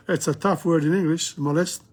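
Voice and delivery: usual speaking voice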